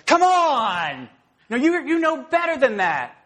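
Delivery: high, loud exasperated call at the TV; frustrated fan. Retold or imitated. Sports fan yelling at the TV, exasperated. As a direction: groan at your team's mistake. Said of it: "you're looking at the T V and you're going".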